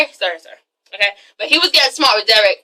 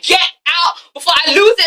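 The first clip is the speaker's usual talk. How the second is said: higher, escalating mock shout; acted shouted order; 5 semitones higher than usual. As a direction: throw her out, about to lose it. German's escalated order to leave.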